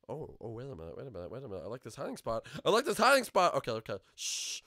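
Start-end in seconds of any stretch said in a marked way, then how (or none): 2.6-3.5 much higher and louder; loud, high-pitched insistence; insistent outburst. Real, not acted. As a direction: blurt it out emphatically. Repeats it insistently.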